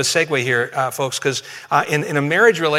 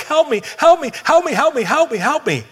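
put-on much higher, louder frantic child's plea; imitating a panicked kid running up for help; high, loud mock panic, repeated fast; distressed child; 11 semitones higher, 4 dB louder than usual. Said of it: "they're breathing hard, and they're kind of crying".

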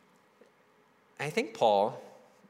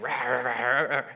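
growly rah rah, rising louder, with a laugh; laughing imitation of a movie thief cursing; mock thief muttering; 5 dB louder than usual. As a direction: mumble fake curses like the Home Alone thief. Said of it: "mutters under his breath".

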